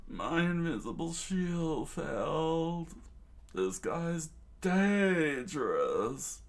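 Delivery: high, slow, swooping figure voice; put-on high voice with stretched words; Pearl character voice. A narrator's reading. Pearl's voice, after looking it up.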